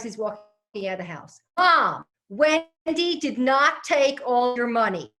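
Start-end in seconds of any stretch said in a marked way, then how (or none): 1.6-5.1 slow, loud, high, spelled-out words; loud slow call. Retold or imitated. Husband assures his hard-of-hearing mother, slow loud call. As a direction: shout it slowly so she can hear. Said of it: "he's getting ready to scream".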